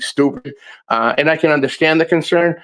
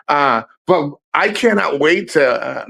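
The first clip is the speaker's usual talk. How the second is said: usual speaking voice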